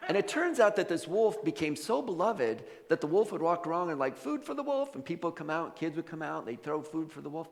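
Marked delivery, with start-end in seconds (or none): 4.2-4.9 high, singsong call at modest volume; mock street call, pitched way up; light, high-voiced hawking.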